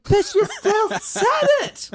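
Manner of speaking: high, loud, slow, breaking into a laugh; amused mock outrage; laughing exasperation. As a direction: laugh through the disbelief. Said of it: "And I'm like".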